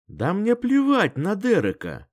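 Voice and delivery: high, loud put-on outburst; fake anger. A narrator's reading. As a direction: snap it with fake indignation. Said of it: "Разозлилась она, но довольно ненатурально".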